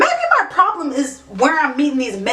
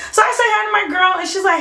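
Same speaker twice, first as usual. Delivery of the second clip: normal talking voice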